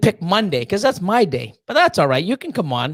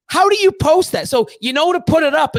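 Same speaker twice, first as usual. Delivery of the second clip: incredulous rant; 10 semitones higher than usual. Real, not acted. Ranting about a deleted post.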